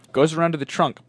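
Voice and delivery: usual speaking voice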